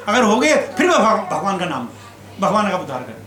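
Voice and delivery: ordinary talk, nothing marked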